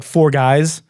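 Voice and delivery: normal talking voice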